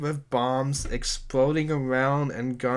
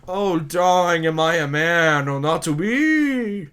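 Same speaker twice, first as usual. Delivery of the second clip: higher, louder swooning stage voice; melodramatic lover; 5 semitones higher, 6 dB louder than usual. Acted. Titus pleading to Yuna, hammed-up swooning. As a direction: overact a lovestruck Shakespeare hero.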